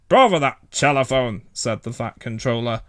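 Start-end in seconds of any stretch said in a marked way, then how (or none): 0.1-1.4 grumbling. A narrator's reading. Controller grumbles at breakfast.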